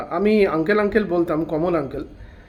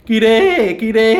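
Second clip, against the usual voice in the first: louder call with a cracking voice; voice breaking; 5 dB louder, much slower than usual. Shown as a demonstration. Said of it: "আমি এরমভাবে".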